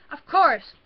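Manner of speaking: loud high exasperated yell; angry cry. Acted. Girl yelling at father and brother, exasperated. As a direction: snap at them both. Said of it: "I cried".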